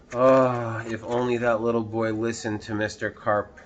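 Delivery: plain reading voice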